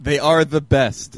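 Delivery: hammy Russian ad tag line; mock Russian accent. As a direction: sell the giraffe like a Russian ad, slowly. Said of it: "you gotta work on your Russian".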